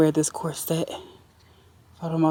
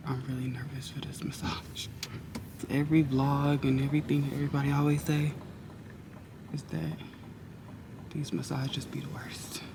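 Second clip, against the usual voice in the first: hushed, nervous whisper in the car; 2 semitones lower, 11 dB quieter than usual. Real, not acted. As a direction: whisper it so nobody hears.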